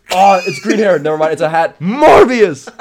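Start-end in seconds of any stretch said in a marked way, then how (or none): 1.8-2.7 higher, louder silly shout of Morbius!; hammed-up podcast joke yell. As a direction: belt out Morbius! in the running-joke voice. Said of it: "saying words in kind of a silly voice".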